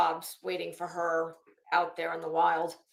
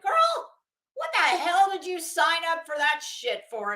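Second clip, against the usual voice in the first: much higher, louder shocked disbelief; incredulous; 8 semitones higher, 5 dB louder than usual. Retold or imitated. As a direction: shriek it at your friend, stunned. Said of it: "I'm like".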